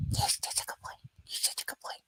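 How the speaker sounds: tender whispered praise for a dog; whispering to dog. Real, not acted. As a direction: hush the puppy gently.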